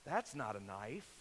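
low, soft, understated movie-hero line; Crocodile Dundee. Acted. Dundee calmly answers muggers with a switchblade, low and soft. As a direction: say it low and unimpressed, no accent attempted. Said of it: "he looks at him and says".

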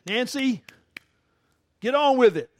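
high, loud mock call from heaven; hammed-up heavenly voice telling Nancy to hurry up; Jesus calling out. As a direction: boom out an impatient summons from heaven. Jesus calls out impatiently.

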